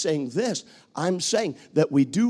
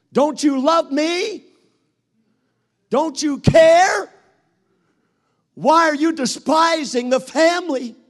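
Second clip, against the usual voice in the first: a hammed-up wife, louder and higher, shouting Don't you care; nagging wife; 6 semitones higher, 6 dB louder than usual. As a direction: whine and accuse him like an upset wife. Wife nags the husband again.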